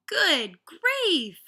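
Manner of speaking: high, loud good-grief burst; exasperated. Acted. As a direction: throw up your hands in dismay. Mother exasperated at the idea.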